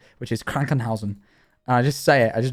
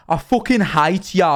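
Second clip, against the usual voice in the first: loud, slow Brummie mock shout; higher, drawn-out Birmingham accent; 4 semitones higher, 5 dB louder than usual. Imitating a Brummie shouting in the street, Brummie accent. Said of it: "Brummies having an argument".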